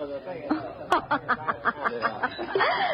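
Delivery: amused mocking laugh; knowing laugh. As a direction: laugh at the naive stranger. Saloon girl laughs at him.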